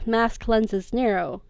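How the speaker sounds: ordinary delivery, nothing marked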